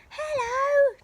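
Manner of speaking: high, loud call upward; shouting up. Acted. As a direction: call up to someone far above. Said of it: "Jack shouted up".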